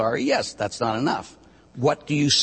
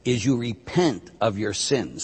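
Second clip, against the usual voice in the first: ordinary talk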